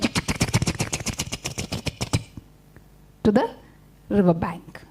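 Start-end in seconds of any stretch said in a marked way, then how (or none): 0.0-2.3 rapid unvoiced tongue clicks, fading; imitated scurrying ticks. Storyteller imitates spider running to the river bank, rapid clicks. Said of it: "He went".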